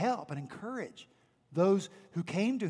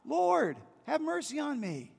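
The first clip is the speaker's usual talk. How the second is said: high pleading cry for mercy; 7 semitones higher than usual. Acted. Mother crying out to Jesus for mercy, high pleading cry. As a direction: cry out desperately, voice pitched up high. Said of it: "The mother cries out".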